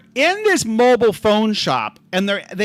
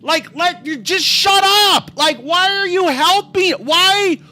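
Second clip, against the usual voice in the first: exasperated venting; 8 semitones higher, 4 dB louder, slower than usual. Retold or imitated. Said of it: "I'm like".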